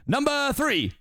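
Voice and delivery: high, firm call; guard voice. Acted. Guard pressing Farquaad to pick, high voice. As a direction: urge the lord toward three. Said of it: "I'm developing voices as we go".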